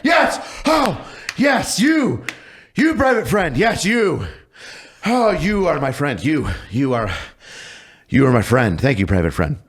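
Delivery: a hammed-up drill sergeant calming into a fake-tender thank you; mock relief. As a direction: sergeant greets his friend, shouting then softening. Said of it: "and I freak out".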